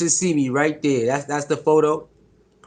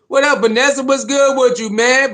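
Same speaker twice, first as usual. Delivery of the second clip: excited greeting; 6 semitones higher, 4 dB louder than usual. Real, not acted. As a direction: stretch the name out loud and happy. Greeting viewer again.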